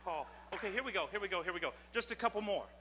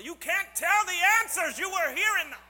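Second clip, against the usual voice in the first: playful shout; 9 semitones higher, 11 dB louder than usual. Real, not acted. Scolding the kid who told the answers, playful shout. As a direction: bellow a joking scold across the room.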